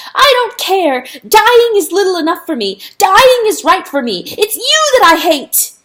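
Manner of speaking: high, loud shriek of defiance. A narrator's reading. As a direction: shriek as if the voice will burst. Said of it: "he shrieked until his voice burst".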